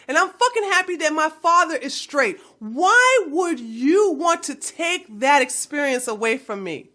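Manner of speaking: ordinary delivery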